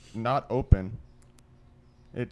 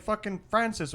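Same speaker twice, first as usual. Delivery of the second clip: higher, blurted name; panicked answer; 10 semitones higher than usual. Retold or imitated. Guy tells the girls who died, higher panicked blurt. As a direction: spit out the name in shock. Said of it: "he's like".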